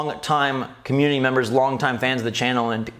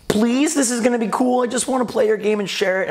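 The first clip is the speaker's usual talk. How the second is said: higher-pitched mock plea; retold pleading; 8 semitones higher than usual. Gamer asking Rockstar for permission, pleading. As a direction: plead your case, lightly acted.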